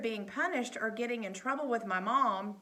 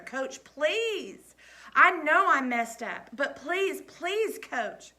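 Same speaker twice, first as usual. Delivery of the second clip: higher, louder desperate pleading; desperate begging; 6 semitones higher, 7 dB louder, much slower than usual. A narrator's reading. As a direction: beg on your knees. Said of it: "fell to my knees and pressed my hands together".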